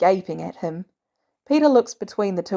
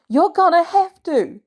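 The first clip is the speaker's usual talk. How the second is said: a loud, insistent order, pitched higher; shout; 10 semitones higher, 6 dB louder than usual. A narrator's reading. May shouting at Peter to stop. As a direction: lay down the law at full volume. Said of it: "May shouts".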